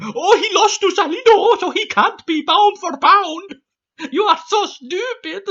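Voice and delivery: mock the troll like a smug boy genius; troll as Dexter. Vlogger reading troll comments in a high mocking cartoon voice. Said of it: "the reason I do that voice when I read out comments".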